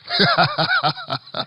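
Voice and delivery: high burst of laughter; real laugh. Real, not acted. Speaker laughing at her own joke, high burst. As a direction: break into a sudden high laugh. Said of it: "I'm so sorry. I don't know why I laughed".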